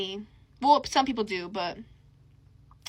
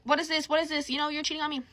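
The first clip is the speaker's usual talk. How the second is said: higher, insistent questioning; retold confrontation; 5 semitones higher, faster than usual. She wakes her boyfriend to confront him, insistent. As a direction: demand answers, replaying the confrontation.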